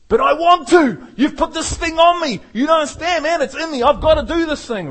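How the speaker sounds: mock the eager believer who can't wait; a hammed-up impatient zealot. Preacher voicing an eager believer.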